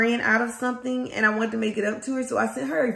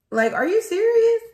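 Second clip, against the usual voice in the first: incredulous outburst; 10 semitones higher, 3 dB louder than usual. Real, not acted. Outraged at bank delays.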